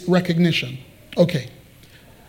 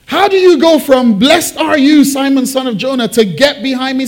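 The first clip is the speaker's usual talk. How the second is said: high, raised, hurried disbelief; incredulous question; 5 semitones higher, 4 dB louder than usual. Shown as a demonstration. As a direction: ask it loudly, astonished by the contradiction. Incredulous contrast of two quotes.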